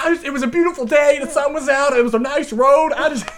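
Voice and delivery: fast, high, loud excuse; mock flustered pleading. Driver explaining himself to the officer, mock flustered pleading. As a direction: plead it in a rush. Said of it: "I was like".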